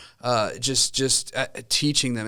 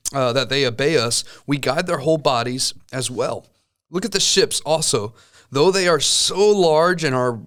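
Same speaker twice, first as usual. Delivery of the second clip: scripture reading; 2 semitones higher, 3 dB louder than usual. Acted. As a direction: read the passage with weight and care. Reads scripture aloud.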